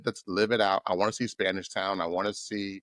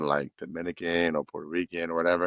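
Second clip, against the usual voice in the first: normal talking voice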